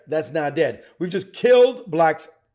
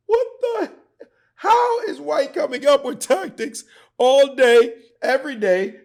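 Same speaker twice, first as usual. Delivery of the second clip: hyped disbelief; 10 semitones higher, 5 dB louder than usual. Acted. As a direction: play up the outrage, stretching the words.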